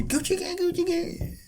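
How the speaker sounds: very high, chirpy chanted hook; mock happy. Shown as a demonstration. Showing co-host the happy version, mock happy. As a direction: sing it sky-high like a happy tune. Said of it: "Or turn it happy".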